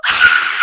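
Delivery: voiceless, forceful "ah"; loud breathy gasp. Acted. Reader overwhelmed by the first kiss, loud gasp. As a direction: let out a big gasp of air. Ends her mock alarm.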